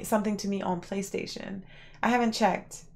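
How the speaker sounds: ordinary talk, nothing marked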